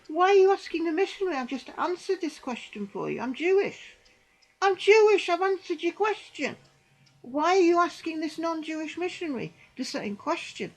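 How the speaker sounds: confronting the prayer group, half-shouting; indignant retold protest.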